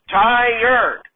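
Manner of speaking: "Tired" drawn out as tie-yerd; "Tired" pulled apart into two syllables. Acted. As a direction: insist on two beats in "Tired". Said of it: "tired is just one syllable".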